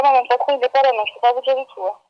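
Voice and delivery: ordinary talk, nothing marked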